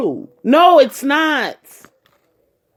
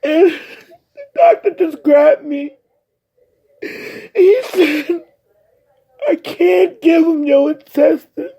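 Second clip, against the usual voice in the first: crying; 5 semitones higher, slower than usual. Real, not acted. Recounting doctor's refusal, crying.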